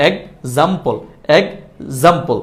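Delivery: "এক্সাম্পল" broken as ek... zampol, starting with e; wrong pronunciation. Shown as a demonstration. Teacher demonstrates the common mistake, wrong pronunciation. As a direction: say "এক্সাম্পল" drawn out, with an opening "ek". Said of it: "ভুল উচ্চারণটা একটু দেখি".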